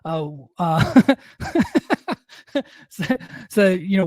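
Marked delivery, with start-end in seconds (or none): 1.4-2.1 sudden high laugh at himself; burst of laughter. Real, not acted. Laughs at his own quote.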